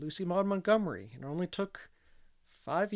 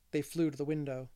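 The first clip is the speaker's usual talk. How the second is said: ordinary delivery, nothing marked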